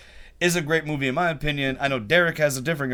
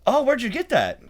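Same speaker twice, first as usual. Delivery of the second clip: put-on high bright girlfriend voice, pitched way up; girlfriend asking; 10 semitones higher than usual. Imitating girlfriend asking where he got it. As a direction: play the curious girlfriend asking. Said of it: "she goes".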